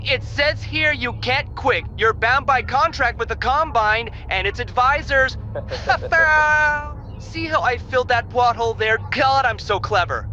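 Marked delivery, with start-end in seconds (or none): 5.4-7.1 smug laugh. Acted. Laughs at own cleverness.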